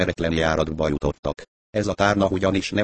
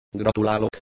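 wry; 5 semitones higher than usual. A narrator's reading. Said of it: "viccentett fanyarul a kis ember".

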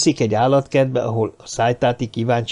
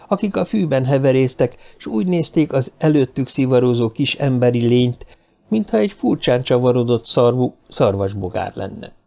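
ordinary delivery, nothing marked

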